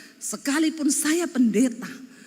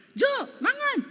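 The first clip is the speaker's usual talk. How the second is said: JO and MANGAN both flung up very high; angry-sounding call; 12 semitones higher than usual. Retold or imitated. Wife calling husband to eat, angry-sounding call. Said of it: "nadamu tuh nesu loh".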